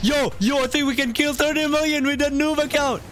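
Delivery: high, fast-breaking excitement; excited gamer calling out high. Real, not acted. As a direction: pitch it up high, thrilled at a sudden chance. Sudden chance to kill top player.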